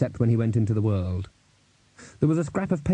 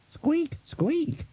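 tiny, very high-pitched squeak; rat squeak. Acted. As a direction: squeak like a little skeletal rat. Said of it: "The Death of Rats squeaked".

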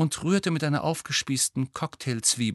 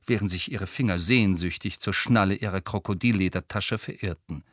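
ordinary delivery

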